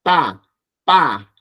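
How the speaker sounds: "Ta, pa" struck hard at the teeth; tense interdental "Ta, pa"; double consonants. Shown as a demonstration. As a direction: hit "Ta, pa" tight with no air.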